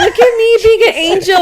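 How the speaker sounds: high, loud laughing grandma voice; mock angel grandma. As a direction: squeal it laughing, like a showing-off angel. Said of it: "she was like".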